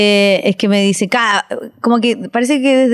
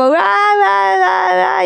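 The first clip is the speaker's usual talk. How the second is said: high, mock-exasperated cry; exasperated outburst; 8 semitones higher, much slower than usual. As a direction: let out a hammed-up huff. Mom's exasperated outburst retold. (arrebato de fastidio exagerado, agudo)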